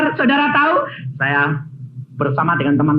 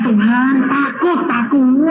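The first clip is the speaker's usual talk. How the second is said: frightened plea; 6 semitones higher, slower than usual. Retold or imitated. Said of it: "Saya bilang".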